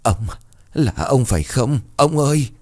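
a hint of a wife calling out uncertainly; faltering old woman. A narrator's reading. Widow seeing her dead husband at the gate, faltering. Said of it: "miệng mấp máy".

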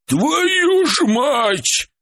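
drawn-out high furious yell; выкрик. Acted. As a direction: yell it through the door, stretched. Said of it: "выкрикнул Харитон из-за двери". (громкий протяжный выкрик)

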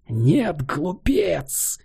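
high furious roar. A narrator's reading. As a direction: bellow at the fool. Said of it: "взревела женщина". (яростный рёв)

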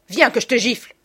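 loud, high maternal threat; furious scolding mother; angry threat. A narrator's reading. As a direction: order the child over for punishment. Mother threatens her son.

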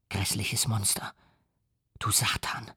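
soft, breathless whispered curse; a revolted whisper at the creature; hissed whisper of hate. A narrator's reading. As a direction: spit it out under your breath. Victor curses the monster.

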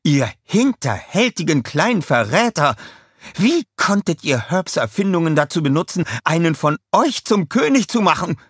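high-pitched accusing outburst; angry tirade. A narrator's reading. Scarlett accusing the Minions of betrayal, angry tirade. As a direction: lay into the traitors, indignant. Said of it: "fuhr sie ihn an".

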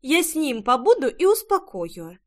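put-on high, earnest child promise; a girl vowing to comfort the cat; reassuring little girl. A narrator's reading. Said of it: "заверила". (детское заверение)

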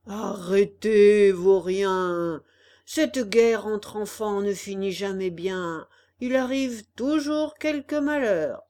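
big raspy voice, slow on the first word; hoarse crab voice. Acted. Old crab scolds the fighting children, hoarse and booming. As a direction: croak it like a trombone with a cold. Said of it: "d'une grosse voix éraillée de trombone enrhumé".

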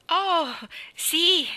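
high, bright eager yes; cheerful and confident. Acted. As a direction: chirp it out, happy and sure. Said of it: "disse Anna allegra e fiduciosa". (allegra e fiduciosa, voce alta)

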